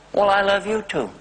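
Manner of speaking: put-on old father answering tenderly; elderly father's voice. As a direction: voice the old father answering his son lovingly. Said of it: "He said".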